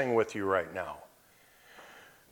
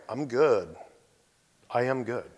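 calm and settled; 2 semitones lower, 4 dB quieter than usual. Retold or imitated. Believer at peace with cancer.

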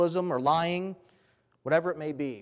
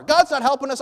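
much higher, louder cry of blame; shouted blame; 10 semitones higher, 10 dB louder than usual. Acted. King shouts his blame to the city, loud and bitter. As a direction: hurl "God's not helping us" from the wall. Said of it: "He says it from the wall where everyone can hear".

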